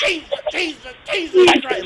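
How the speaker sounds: high, loud imitated echo; echoing cry. The video plays on three phones, high and loud echo. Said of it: "you just hear".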